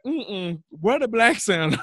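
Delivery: put-on high, laughing kid voice. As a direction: mimic a loud kid asking. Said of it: "he be like".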